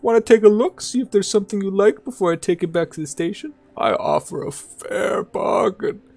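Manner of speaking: put-on high old trader voice; old man voice. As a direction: play the old scavenger offering goods.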